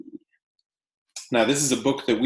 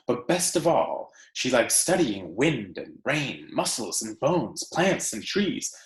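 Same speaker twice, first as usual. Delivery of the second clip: story read aloud; 3 semitones higher than usual. Acted. Teacher reading The Tree Lady to her class, expressive read-aloud. Reads picture book to class.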